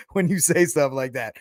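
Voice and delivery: normal talking voice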